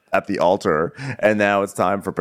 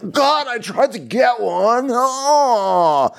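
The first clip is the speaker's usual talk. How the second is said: much higher, slow, wailing complaint; mock whining Austin; 3 dB louder, much slower than usual. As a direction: wail it like a soaked, hard-done-by groom. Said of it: "and he goes".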